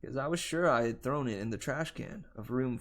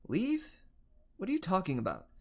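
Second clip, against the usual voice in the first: incredulous; 7 semitones higher than usual. Acted. Dazed girl brushes off warning.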